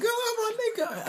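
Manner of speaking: high, excited yelp of delight; hyped-up fan shout; hype exclamation. Retold or imitated. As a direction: squeal it out like a thrilled fan. Excited reaction to a character.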